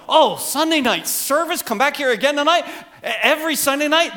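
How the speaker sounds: high-pitched, questioning disbelief; mock incredulity. People today looking back on night services, mock incredulous. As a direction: pitch it up, balking at the idea.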